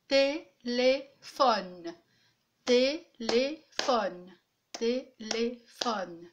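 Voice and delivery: "téléphone" very slowly as té, lé, phone; three separate syllables. Shown as a demonstration. Teacher giving another three-syllable word, slowly split apart. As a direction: isolate each syllable of "téléphone" with a pause. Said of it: "un autre mot avec trois syllabes".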